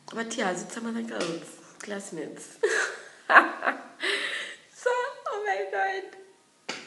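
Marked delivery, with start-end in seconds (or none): 2.6-6.8 high, slow laughing exclamation; laughing through the words. Real, not acted. Laughing while telling friends about the caning.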